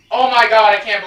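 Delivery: loud, excited exclamation; amazed outburst. Real, not acted. Gamer reaching the star first try, amazed shout. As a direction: burst out in amazement.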